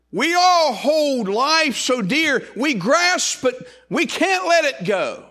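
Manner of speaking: high, loud, drawn-out preacher's cry; swelling, fervent pulpit proclamation; full-force preaching. Real, not acted. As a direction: preach it out, stretching the words.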